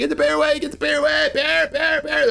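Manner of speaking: high pleading shouts; crowd screaming. Retold or imitated. Onlookers screaming at the bear to go away, high panicked calls. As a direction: call out in panic to drive the bear off. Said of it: "Screaming, screaming, screaming".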